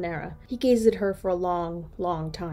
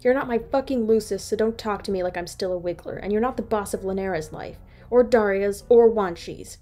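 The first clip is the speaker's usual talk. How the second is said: angry; 3 semitones higher, 7 dB louder than usual. A narrator's reading. Angry rant at Bronya.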